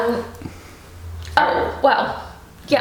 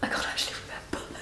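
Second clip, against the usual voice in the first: fast whispered aside; whispering; 14 dB quieter, faster than usual. Real, not acted. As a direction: whisper it under your breath. Muttered aside about the prices.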